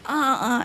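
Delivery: half-laughing mock moans of pain; playful imitation of a patient's moan; retold moaning. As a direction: moan lightly, laughing through it. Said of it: "I moan a little".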